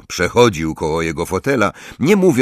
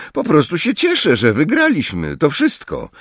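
higher, light and innocent; Harry covering; 10 semitones higher than usual. Acted. Harry hiding his grin from Ron, falsely innocent. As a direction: act innocent, stifling amusement.

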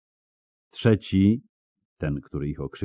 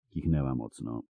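usual speaking voice

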